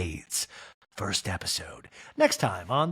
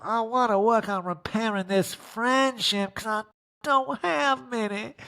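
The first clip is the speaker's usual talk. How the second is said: high, slow, prim friend voice; sweetly prim, pitched way up; mock high Dorit; 9 semitones higher, slower than usual. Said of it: "Dorit's like".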